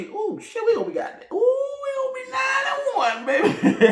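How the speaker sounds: very high, laughing squeal; giddy high-pitched celebration; excited squeal. Real, not acted. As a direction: squeal with glee over the record. Excited squeal about the record.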